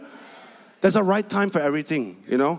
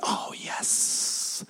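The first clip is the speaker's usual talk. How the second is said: breathless airy "oh yes", nearly whispered and much softer; mock gold digger; 9 dB quieter than usual. Preacher imitating gold diggers eyeing a rich man, airy mock swoon. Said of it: "all the gold diggers will be like".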